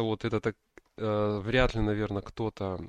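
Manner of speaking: ordinary talk, nothing marked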